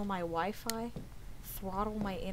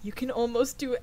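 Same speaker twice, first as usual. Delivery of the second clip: ordinary talk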